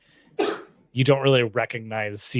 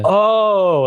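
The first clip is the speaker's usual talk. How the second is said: surprised oh; 9 semitones higher, 10 dB louder than usual. Retold or imitated. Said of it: "I'm like".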